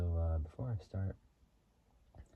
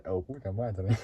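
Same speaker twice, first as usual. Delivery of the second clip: lightly mimicked Dominican truck driver, louder; imitated truck driver; 6 dB louder, slower than usual. Vlogger quoting a movie truck driver, amused imitation. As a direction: quote him while about to crack up. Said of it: "The way he talks".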